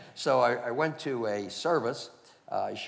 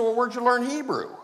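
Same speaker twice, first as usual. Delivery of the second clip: much higher and louder, eager question; excited demand; 10 dB louder than usual. Retold or imitated. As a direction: fire the question at him.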